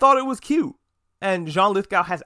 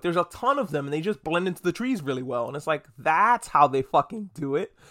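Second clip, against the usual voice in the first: usual speaking voice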